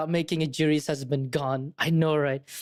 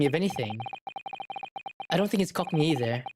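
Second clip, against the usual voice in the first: ordinary delivery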